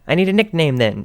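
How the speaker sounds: insisting on a nickname, grinning; insistent grin. A narrator's reading. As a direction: demand it with a grin. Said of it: "she insisted with that scary grin".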